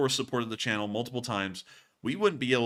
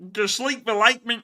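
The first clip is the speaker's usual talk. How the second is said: Kermit; 11 semitones higher, 6 dB louder than usual. Acted. Reading out a viewer's animal noises, as Kermit. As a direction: attempt a high-pitched frog impression. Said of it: "That's my best Kermit of doing that".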